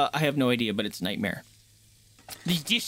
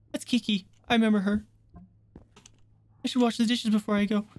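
usual speaking voice

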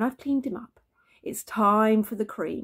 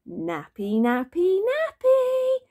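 each word higher and louder; playful shouting; 11 semitones higher, 5 dB louder, slower than usual. A narrator's reading. Dad running after baby, shouting higher each time. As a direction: build from talk to a high, loud chant.

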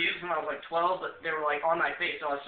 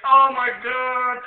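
laughing-crying exclamation; 6 semitones higher, 8 dB louder, much slower than usual. Retold or imitated. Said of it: "laughing and crying at this point".

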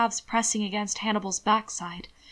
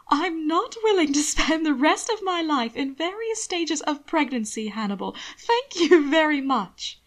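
Will voice; 6 semitones higher, 3 dB louder than usual. A narrator's reading. Will's voice, warning.

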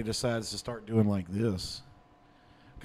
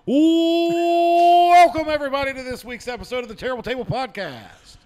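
long, high held opening cry, then ringing intro; announcer; 11 semitones higher, 9 dB louder than usual. Acted. Host opening the podcast like a fight card, big announcer voice. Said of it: "I'm doing my best announcer voice".

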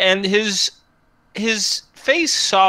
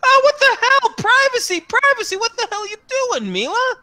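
sudden very high startled yelp; feminine yelp; 4 dB louder than usual. Acted. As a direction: squeal it out, caught off guard. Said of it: "almost a feminine yelp".

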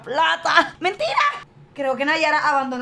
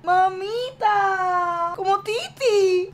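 mock tearful; 6 semitones higher, much slower than usual. Moved by niece's dream.

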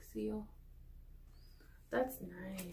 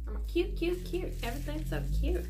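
excited gushing; 3 semitones higher, 8 dB louder than usual. Real, not acted. Gushing over cute stickers in the box. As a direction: gush over everything.

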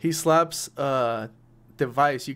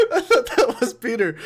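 high, loud laughter through the words; laughing hard; 3 dB louder than usual. Real, not acted. GM cracking up after someone burped, laughing hard. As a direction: crack up mid-sentence.

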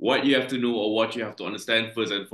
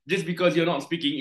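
ordinary talk

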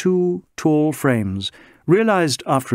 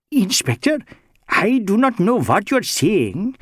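Bengali social worker. Acted. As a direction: play the mild Bengali gentleman pleading his innocence. Chatterjee voiced by narrator.

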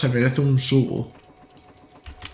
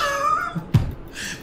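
high squeaky laugh; 4 dB quieter than usual. Real, not acted. Laughs after his impression.

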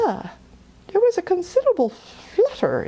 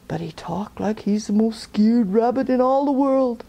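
plain reading voice

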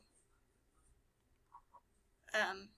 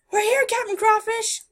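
loud high call; 9 semitones higher, 7 dB louder than usual. Real, not acted. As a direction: shout across to him. Calling out to captain.

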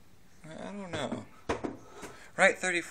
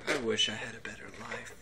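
soft, quick whispered wish; mock forlorn whisper; 4 semitones lower, 7 dB quieter, faster than usual. Voicing a guy just sitting there, mock forlorn whisper. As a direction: whisper it like a secret sorrow, playfully.

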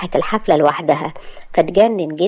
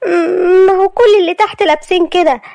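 high, crying protest; tearful girl; 11 semitones higher, 4 dB louder than usual. Acted. Maria answering Asad's scolding, high and tearful. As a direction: defend herself close to tears.

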